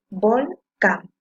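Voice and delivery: volcán slowly cut into vol and cán; split into syllables. Shown as a demonstration. Teacher dividing a word for pupils, volcán syllable by syllable. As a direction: leave a gap inside volcán, both beats firm. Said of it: "Si separo en sílabas volcán, voy a decir".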